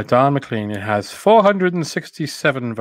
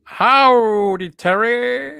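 cowboy howdy; 9 semitones higher, much slower than usual. Acted. [name] drops a cowboy greeting on Terry the host, hammed-up cowboy voice. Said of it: "Don't, don't, don't do it now".

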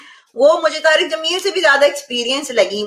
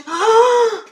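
mock shocked gasp; 10 semitones higher than usual. Streamer reacts to being accused of mocking Islam, mock shocked gasp. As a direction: gasp in exaggerated horror.

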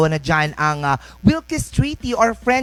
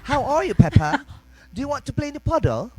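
British cartoon accent, swinging pitch; playful British English, laughing in between; Peppa; 5 semitones higher than usual. Showing off British accent to co-host, Peppa-style British. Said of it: "Yeah, uh, Peppa?".

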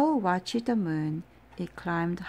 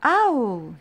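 loud high shout upstairs; 5 semitones higher, 8 dB louder than usual. Acted. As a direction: call out loudly up the stairs. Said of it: "he shouted".

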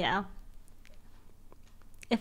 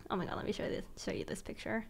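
whisper; 8 dB quieter, faster than usual. Real, not acted. Sharing photo quietly.

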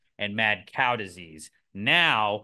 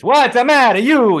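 much higher and louder hammed-up Italian shout; loud mock Italian; 12 semitones higher, 14 dB louder than usual. Explaining the pizza name, a loud hammed-up Italian cry. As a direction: belt it like a pizza man dumping every topping. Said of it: "with an Italian".

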